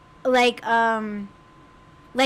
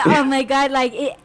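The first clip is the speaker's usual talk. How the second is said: usual speaking voice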